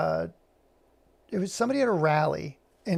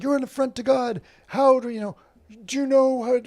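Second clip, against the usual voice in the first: higher, slightly louder imitated rant; hammed-up zealot yelling condemnation; mock screaming; 8 semitones higher, 5 dB louder than usual. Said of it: "just screaming these epithets".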